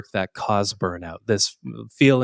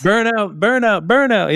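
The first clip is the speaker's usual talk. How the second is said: recalling; 10 semitones higher, 4 dB louder than usual. Real, not acted. Recalling the forgotten item.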